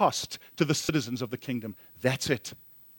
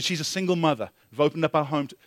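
ordinary talk

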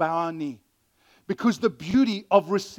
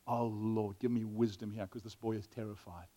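hushed prayer under the breath, much softer; quiet prayer; 10 semitones lower, 14 dB quieter than usual. Retold or imitated. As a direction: pray it softly to yourself. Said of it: "you just go".

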